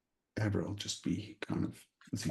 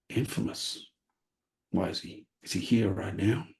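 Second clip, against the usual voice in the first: feigned surprise. Acted. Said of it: "feign surprise".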